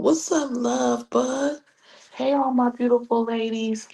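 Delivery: high drawn-out greeting. Real, not acted. Greeting viewers joining live.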